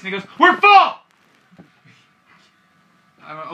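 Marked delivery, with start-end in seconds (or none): 0.4-0.9 high, loud bark of refusal; sudden full-voiced mock shout; yelling. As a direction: yell it much higher, like an irate store clerk. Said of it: "why are you yelling".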